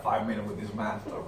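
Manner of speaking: ordinary delivery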